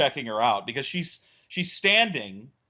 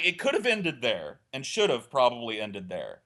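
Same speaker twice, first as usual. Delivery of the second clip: ordinary delivery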